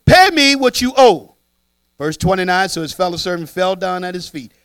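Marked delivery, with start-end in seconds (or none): 0.1-1.4 demanding brother. Acted. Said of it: "he says".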